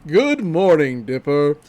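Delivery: loud mock-deep announcer tone; imitated deep manly greeting. Shown as a demonstration. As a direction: put on a real deep, booming voice. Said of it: "he says it in a real deep voice".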